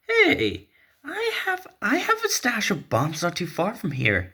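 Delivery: very high, squeaky excited offer; eager Jason. Acted. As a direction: pipe up eagerly with the idea.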